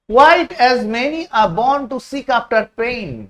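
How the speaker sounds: slow, deliberate reading; slow reading aloud. Shown as a demonstration. Teacher reading the quote to the class, slow. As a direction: stretch each word out. Reading the English text slowly.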